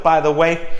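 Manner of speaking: normal talking voice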